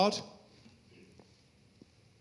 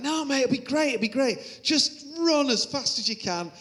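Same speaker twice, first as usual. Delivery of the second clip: high, eager pitch of a persuading mate; mock excited urging, lightly voiced; 7 semitones higher, 4 dB louder than usual. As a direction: talk him into it, high and keen. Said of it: "he was like".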